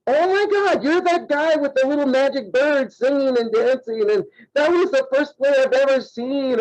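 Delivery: high-pitched gushing lady at the register; starstruck fan. Retold or imitated. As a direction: gush like a fan spotting a star. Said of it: "the lady at the register just goes".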